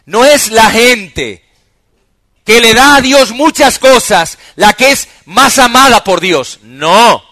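loud, high preaching, every phrase driven hard; full-force preaching. Real, not acted. Pastor preaching to the congregation, loud and high. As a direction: preach it out to the whole church.

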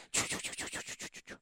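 unvoiced mock whispering chu chu; whispered chatter. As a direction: whisper like giggling kids in bed. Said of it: "las escuchábamos cuchichear". (imitando el cuchicheo de las niñas en susurro)

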